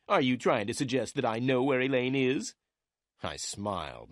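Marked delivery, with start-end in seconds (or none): 0.1-2.5 higher, indignant accusing question; angry. A narrator's reading. Lawyer Meyer suspects the detective is accusing him, angry. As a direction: challenge the insinuation with raised pitch. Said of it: "Mister Meyer replied angrily".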